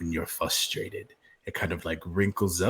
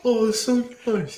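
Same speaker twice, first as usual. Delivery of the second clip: high, laughing coo; laughing mock coo; 6 dB louder than usual. Player mimics crew cooing over the pair, laughing.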